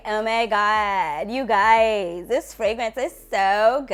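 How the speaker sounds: put-on drawn-out Valley girl vowels; slow, gushing Valley girl accent. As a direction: mimic your old Valley girl self, stretching the words. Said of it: "my Valley girl accent was real".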